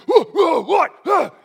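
high, loud startled whoops; startled caveman. Retold or imitated. Preacher acting out his wife waking him, startled shout. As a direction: yelp as if jolted awake. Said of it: "I turned into a caveman".

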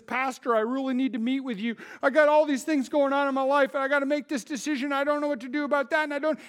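a hint of a flustered member asking the pastor for help; worried congregant. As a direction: rush it like someone overwhelmed by choices. Congregant voiced asking for counsel.

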